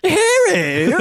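very high, loud cartoon squeal; cartoonish Chinese caricature. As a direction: squeal a hammed-up caricature accent.

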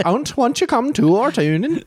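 very high mock Swedish lilt; Swedish accent. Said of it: "Swedish is more like".

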